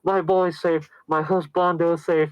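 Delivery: high, hammed-up contented sigh of relief; mock happy relief. Voices the relieved happy ending.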